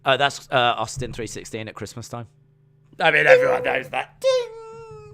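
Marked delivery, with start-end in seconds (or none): 4.2-5.1 much higher sung ding; ding like a quiz show bell; mock quiz bell ding. Playing quiz host after a guess: high bell ding. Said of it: "game bell imitated".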